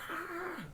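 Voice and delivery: squeaky minion battle cry; high shriek like a minion; minion yell. Retold or imitated. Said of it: "That's my impression of a minion".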